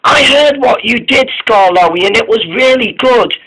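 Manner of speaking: Caitlin's voice. A narrator's reading.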